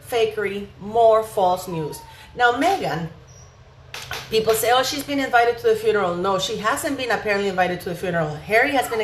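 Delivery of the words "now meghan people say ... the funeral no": usual speaking voice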